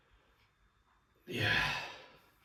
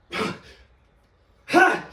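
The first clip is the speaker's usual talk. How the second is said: effort grunt; 3 dB louder than usual. Real, not acted. Lifting effort.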